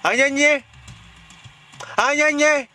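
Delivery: high-pitched chiding "ay, ay, ay"; mock scolding. Chiding the child to make her cry, mock scolding. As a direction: tut in an exaggerated high voice. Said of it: "porque yo la regaño".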